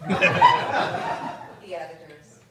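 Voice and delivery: deadpan mock-formal letter read in verse; reading a mock rejection letter poem over audience laughter; comic poem reading. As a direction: read it like a snooty editor's form letter.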